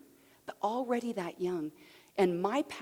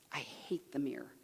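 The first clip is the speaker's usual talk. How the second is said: low, near-whispered admission; soft, low retold confession; hushed confession; 6 semitones lower, 8 dB quieter than usual. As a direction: confide it under your breath, imitating her. Retells woman's confession in office.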